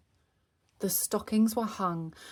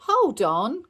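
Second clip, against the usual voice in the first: cried; 5 semitones higher, 8 dB louder than usual. Acted. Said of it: "cried Dad".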